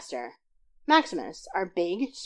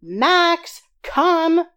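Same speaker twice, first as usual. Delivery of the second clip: much higher and louder, calling across the yard; calling out to dog; 10 semitones higher, 9 dB louder than usual. A narrator's reading. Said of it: "I yelled".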